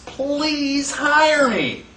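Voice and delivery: slow, drawn-out begging; pleading. Acted. Depression worker begging an employer, slow pleading. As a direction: plead like a desperate job seeker. Jobless people begging for work.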